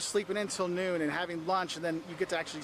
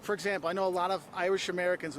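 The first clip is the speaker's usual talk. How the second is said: ordinary talk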